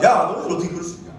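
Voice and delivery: quick mock-indignant scolding; acted angry telling-off; confronting. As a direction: demand an answer, hurt and accusing. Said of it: "막 따집니다".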